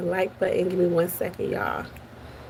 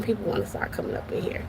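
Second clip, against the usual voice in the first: a quick whispered aside; whispered gossip to the chat; 8 dB quieter, faster than usual. Real, not acted. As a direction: lean in and whisper it. Hushed aside on stream.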